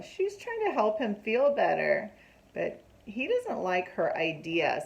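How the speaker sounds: ordinary delivery, nothing marked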